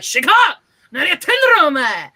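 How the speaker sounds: loud, high mock gangster bark at a student; fake-angry yakuza yell, hammed up. Teacher playing yakuza for laughing students, mock angry yell. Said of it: "the Yakuza voice".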